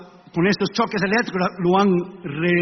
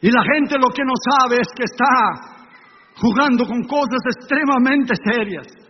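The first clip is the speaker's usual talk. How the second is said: fervent preaching; 3 semitones higher than usual. Acted. Preaching with rising force.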